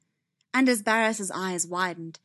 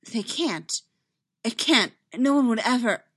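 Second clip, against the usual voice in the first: raised, emphatic disbelief; alarmed refusal to believe it; shocked denial; 4 semitones higher, 6 dB louder than usual. Acted. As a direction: protest in broken-off bursts. Horrified denial in the story.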